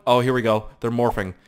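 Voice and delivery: ordinary delivery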